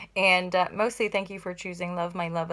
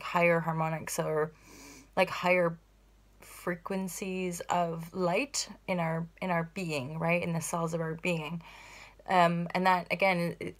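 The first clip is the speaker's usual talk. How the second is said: ordinary talk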